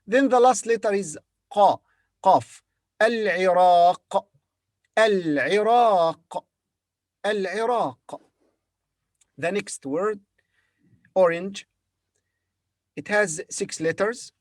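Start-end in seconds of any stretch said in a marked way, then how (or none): 3.0-8.2 al-Iraq slowly with a throaty ayn; Arabic al-Iraq. Shown as a demonstration. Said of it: "In Arabic, we say the Iraq".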